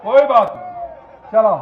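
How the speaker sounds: usual speaking voice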